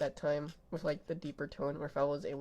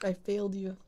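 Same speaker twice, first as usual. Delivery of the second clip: ordinary talk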